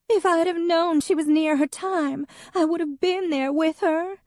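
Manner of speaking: an ex weeping over her dead cat. A narrator's reading. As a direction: sob the words out in grief. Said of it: "Hadley wept".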